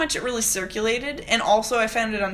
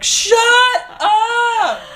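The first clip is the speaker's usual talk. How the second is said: mock yell; 13 semitones higher, 10 dB louder than usual. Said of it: "they were like".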